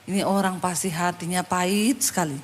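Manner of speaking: low, hushed aside to oneself; inner thought. Retold or imitated. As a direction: think it aloud under your breath. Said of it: "Dalam hati saya".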